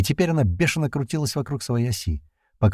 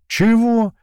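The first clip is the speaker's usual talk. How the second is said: high, louder baffled question; puzzled query; 13 semitones higher, 5 dB louder than usual. A narrator's reading. Comrade who didn't understand the radio report, puzzled query. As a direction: ask back, not understanding. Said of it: "не поняв, переспросил упырь".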